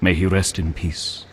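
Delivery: low, soft and reverent; a quiet prayer for his late father; solemn blessing. Acted. Knight to Geralt about his late father, softly reverent.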